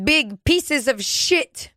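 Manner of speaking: high, very slow mock scolding; mock outrage. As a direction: drag out the insult in a put-on voice. Joining the mock Greta rant.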